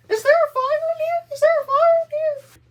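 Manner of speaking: high, loud panicked mock shriek; frantic Linda. Roommate panicking at the door, high loud shriek. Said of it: "And she's like".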